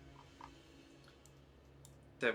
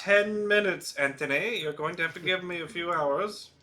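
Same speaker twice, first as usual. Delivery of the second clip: raised, higher-pitched mock scolding yell; yelling from the kitchen; 7 semitones higher, 7 dB louder than usual. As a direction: holler it back through the house. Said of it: "He yells from the kitchen".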